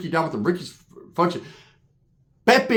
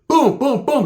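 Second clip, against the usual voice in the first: punch sound effects; 8 semitones higher, 4 dB louder, slower than usual. Retold or imitated. Imitating Sheila's punches.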